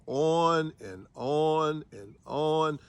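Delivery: slow, dragged-out repetition; wearily elongated words; drawn-out tedium. Shown as a demonstration. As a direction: stretch each "on" as if it will never end. Mimes an endless boring sermon.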